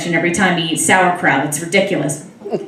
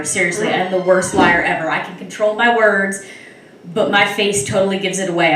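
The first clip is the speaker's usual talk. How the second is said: usual speaking voice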